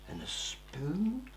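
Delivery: whisper. Acted. Listing treasure items.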